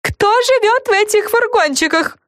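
high wailing question. A narrator's reading. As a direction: wail it out. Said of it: "взвыла Френ".

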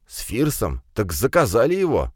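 plain reading voice

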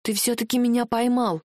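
usual speaking voice

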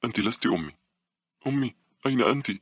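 put-on high, anxious little-boy voice; a lost child calling for his mother; frightened boy. A narrator's reading. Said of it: "بدا الفتى خائفا وهو يقول".

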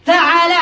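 فعل said fa-'a-la, middle vowel a; fatha on ain. Shown as a demonstration. Teacher listing verb patterns, فعل as fa'ala. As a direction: say فعل with an open a after the ain. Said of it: "بفتح العين".

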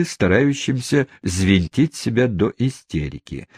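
plain reading voice

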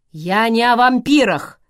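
loud angry shout. Acted. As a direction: shout it out in protest. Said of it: "крикнул Томас".

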